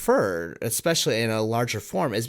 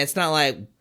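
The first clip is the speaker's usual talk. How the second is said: ordinary talk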